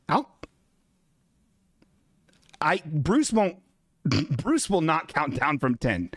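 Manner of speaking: ordinary delivery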